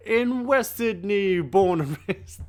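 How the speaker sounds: high, slow, drawn-out mock Aussie drawl; mock Aussie brag. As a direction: ham up an Australian accent, slowly. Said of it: "Sorry, I had to".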